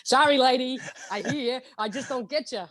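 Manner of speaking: put-on baffled vendor voice; baffled shopkeeper. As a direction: imitate a puzzled New Yorker serving her. Retells baffled New York shopkeeper.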